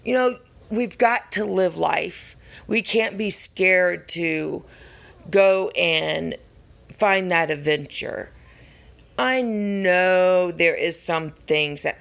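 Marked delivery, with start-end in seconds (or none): none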